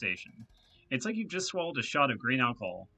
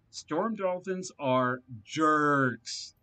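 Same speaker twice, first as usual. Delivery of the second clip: slow emphatic; 3 semitones higher, 4 dB louder, much slower than usual. Acted. NPC emphatically repeats his point.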